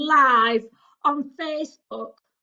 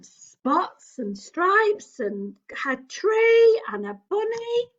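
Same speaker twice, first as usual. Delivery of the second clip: ordinary talk, nothing marked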